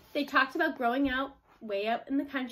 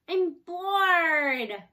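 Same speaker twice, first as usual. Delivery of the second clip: bored whine; 5 semitones higher, 7 dB louder than usual. A narrator's reading. Bored girl whining in tree.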